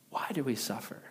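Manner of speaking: soft unvoiced repeat; whispered echo. Pastor echoing the chorus line to the congregation, whispered. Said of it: "together they sing".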